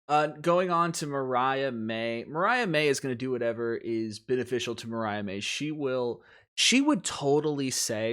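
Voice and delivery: ordinary delivery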